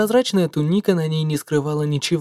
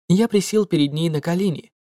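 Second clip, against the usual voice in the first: ordinary delivery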